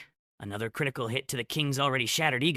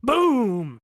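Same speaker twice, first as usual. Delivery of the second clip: very high, loud drawn-out boom; shouted explosion; 13 semitones higher, 11 dB louder than usual. A narrator's reading. Narrator voicing the blast that hits Cirrus, shouted. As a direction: yell the blast out long and loud.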